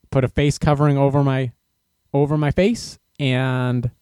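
ordinary talk, nothing marked

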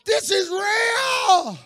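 high, loud crying shout; retold scream. Mister Jenkins jumping up at the altar, crying out loud. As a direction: cry it out in sudden awe. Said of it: "And he said again".

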